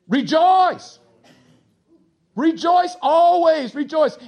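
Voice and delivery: loud, high exhortation to the congregation; exultant proclamation. Acted. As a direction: proclaim it boldly, voice lifted high. Preacher proclaiming the apostle's command.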